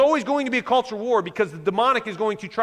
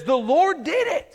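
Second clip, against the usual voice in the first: lifted high and slow, ringing out; proud exclamation; 8 semitones higher, slower than usual. Retold or imitated. David crediting God after the victory, exclaiming. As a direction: proclaim the victory as God's. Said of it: "he goes and he says".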